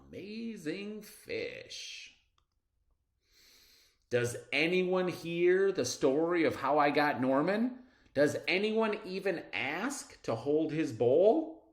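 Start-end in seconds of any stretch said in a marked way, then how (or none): none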